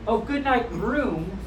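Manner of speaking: ordinary delivery, nothing marked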